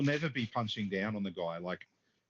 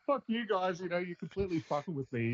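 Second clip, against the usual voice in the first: mock losing it; 6 semitones higher than usual. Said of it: "he's just losing it".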